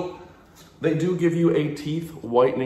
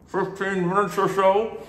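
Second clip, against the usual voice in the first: talking around mouth tray; light tray in mouth; 8 semitones higher than usual. Real, not acted. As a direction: lips stretched over tray. Seen 0: Glowing whitening tray in mouth from 0.9 to 4.6; removed by 5.0.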